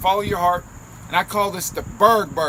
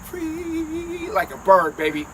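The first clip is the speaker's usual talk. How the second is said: sing-song; 11 semitones higher, 4 dB louder, slower than usual. Acted. Playful sing-song aside.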